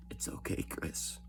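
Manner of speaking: whispered softly, coaxing a secret out. Retold or imitated. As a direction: hush it like you're urging him to confess. Investigator coaxing a confession.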